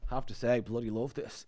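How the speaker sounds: lightly imitating a Dublin 4 lad; Dublin 4 accent. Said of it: "Dublin four accent that you're doing".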